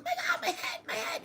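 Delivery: tiny, very high squeaky chipmunk voice; little chipmunk. Retold or imitated. Astral traveller seeing their huge head, little chipmunk voice. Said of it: "I sounded like a little chipmunk".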